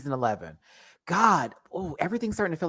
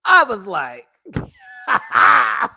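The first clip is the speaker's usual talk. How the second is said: laughing scream; 9 semitones higher, 8 dB louder, much slower than usual. Real, not acted.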